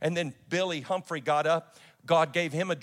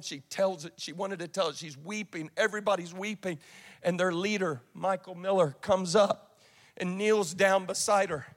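normal talking voice

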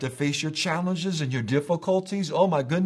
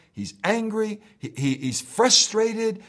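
ordinary talk, nothing marked

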